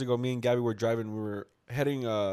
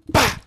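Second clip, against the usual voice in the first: sudden crash sound; imitated impact noise; 10 semitones higher, 9 dB louder than usual. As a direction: burst out the bang of a bump. Imitates car hitting the bump.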